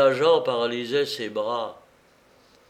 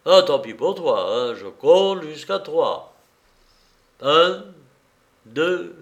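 mildly menacing, raised voice; a louder threat, counting it out; 4 semitones higher, 6 dB louder than usual. A narrator's reading. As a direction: threaten with a loud, deliberate count. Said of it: "il ajouta d'une voix menaçante".

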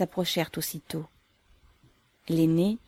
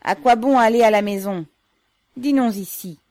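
loud, pitched-up announcement; carrying, raised voice; 5 semitones higher, 10 dB louder than usual. A narrator's reading. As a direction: say it for all to overhear. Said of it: "dit-il à haute voix".

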